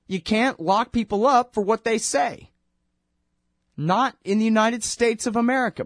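high, firm mock judge laying down the law; imitating the judge. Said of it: "And the judge says".

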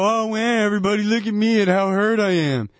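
high, whiny moan; milk the fall for attention; mock self-pity. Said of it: "lay there and go".

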